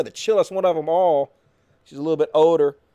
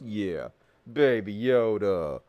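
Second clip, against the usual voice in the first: usual speaking voice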